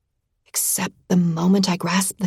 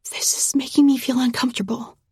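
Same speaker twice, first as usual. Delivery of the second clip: small breathy whisper, higher; murmured whisper; 5 semitones higher, faster than usual. A narrator's reading. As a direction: murmur it nervously. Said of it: "I murmur".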